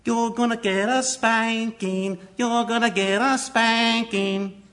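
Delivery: put-on slow, high child's taunting voice; a high, slow child's singsong taunt. Shown as a demonstration. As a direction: tease like a little kid. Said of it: "Different ages".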